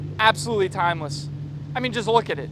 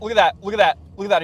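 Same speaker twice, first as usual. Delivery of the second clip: normal talking voice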